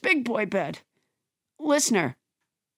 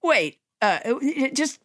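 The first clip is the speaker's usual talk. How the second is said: normal talking voice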